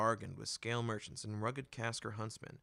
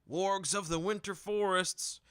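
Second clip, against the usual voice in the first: loud, slow market-crier announcement; merchant announcing; 9 semitones higher, 7 dB louder, slower than usual. A narrator's reading. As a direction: proclaim it to the crowd, drawn out. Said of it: "another merchant announced".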